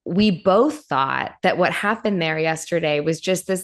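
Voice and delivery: ordinary delivery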